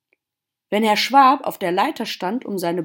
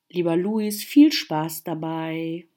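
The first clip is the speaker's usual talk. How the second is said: usual speaking voice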